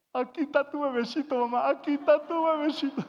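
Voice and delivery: put-on high, loud babyish voice; hammed-up little-boy whine, high and loud; mama's little baby. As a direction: call out to mama like a big baby. Son suddenly plays mama's baby.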